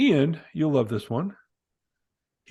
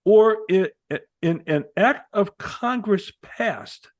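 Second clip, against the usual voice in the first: slow, deliberate reading with "act of Congress passed" spaced out; raised pitch, weight on "act of Congress passed"; emphatic rereading; 6 semitones higher, 4 dB louder, slower than usual. Shown as a demonstration. Rereading the clause to explain it to a co-host, "act of Congress passed" slow and emphatic. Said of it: "So let me read it again".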